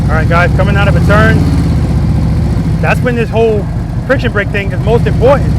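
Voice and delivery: loud, carrying cues across the practice lot; coaching calls. Real, not acted. Riding instructor shouting cues to a student on the bike, loud. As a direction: call the clutch rhythm out to the rider.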